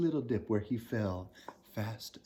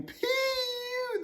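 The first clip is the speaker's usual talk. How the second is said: very high tiny animal cry; squeaky critters. Acted. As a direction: squeal it like little mice. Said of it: "they cried".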